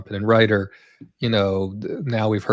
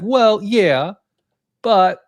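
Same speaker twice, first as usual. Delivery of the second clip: higher, louder mock-hesitant agreement; imagined studio reply; 9 semitones higher, 5 dB louder, much slower than usual. Imagining Lucasfilm bargaining with the director, mock agreement. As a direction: voice a studio conceding, then hedging. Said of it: "Lucasfilm probably said".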